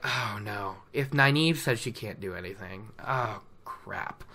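sinking realization, muttered under his breath; worried mutter. Acted. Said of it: "and he's just like".

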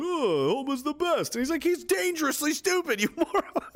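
squeaky imitation of Frank Grimes ranting; high cartoon voices. Acting Simpsons characters, high voice.

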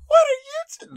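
loud, very high falsetto, cut short; sudden burst of put-on falsetto; high-pitched voice attempt. Shown as a demonstration. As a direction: start a squeaky character voice and abandon it. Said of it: "It's very high-pitched".

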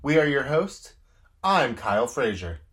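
upbeat host intro read; podcast intro. Acted. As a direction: announce the show with flair.

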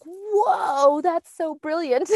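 high, drawn-out amazed whoa; acted-out burst of amazement; awed realization. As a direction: gush in wonder, pitch soaring. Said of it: "when you feel it from the Lord, you're like".

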